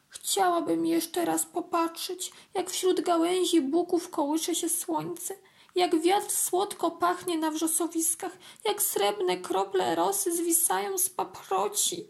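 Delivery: high, longing ant voice; wistful little ant. A narrator's reading. Drowning ant longs for sun and heather, wistful. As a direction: dream aloud in a small high voice, drawn out. Ant's last wistful wish.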